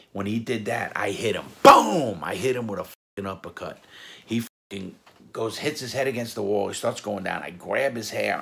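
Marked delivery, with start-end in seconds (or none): 1.6-2.2 very loud, high shouted impact; sudden booming exclamation, pitch leaping up; explosive punch sound. Retold or imitated. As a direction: shout the blow as it lands. Acting out the punch landing.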